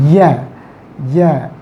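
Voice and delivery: YA, a short isolated y-glide syllable; consonant glide demo. Shown as a demonstration. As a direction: sound out "Ya" on its own, twice. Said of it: "begins with this, uh, consonant sound".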